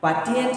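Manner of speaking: mocking the common mistaken t; wrong t sound. Shown as a demonstration. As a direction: stress the wrong t in पार्टिएंट. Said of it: "पार्टिएंट नहीं है".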